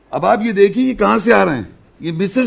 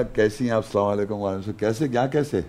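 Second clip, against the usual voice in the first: low, soft imitation of a polite greeting; calm, casual retelling; 7 semitones lower, 7 dB quieter than usual. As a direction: act out an easy, unhurried hello quietly. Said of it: "انہوں نے آرام سے بولا". (آرام سے، دھیمی آواز میں نقل)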